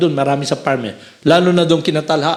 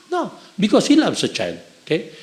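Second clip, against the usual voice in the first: usual speaking voice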